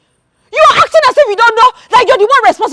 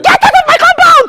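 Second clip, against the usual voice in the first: higher, angry shout to leave; furious order out; 8 semitones higher than usual. Acted. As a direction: throw him out of the compound. Wife drives the man away.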